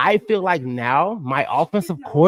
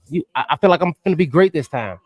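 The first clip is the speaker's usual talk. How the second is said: usual speaking voice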